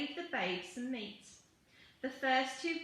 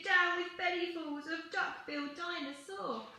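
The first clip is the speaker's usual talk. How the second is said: high, chanted dinosaur protest; singsong high rebel chant; hooting; 6 semitones higher than usual. A narrator's reading. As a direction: hoot it out in a pitched-up voice. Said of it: "and he hooted".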